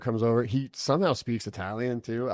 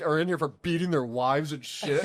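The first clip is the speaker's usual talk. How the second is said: high, loud hammed-up complaint; mock exasperation; 8 semitones higher, 6 dB louder than usual. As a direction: imitate a buddy protesting that you're embarrassing him. Friend complains about embarrassing call.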